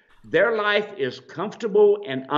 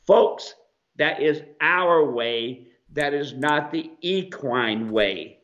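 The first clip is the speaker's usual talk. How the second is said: normal talking voice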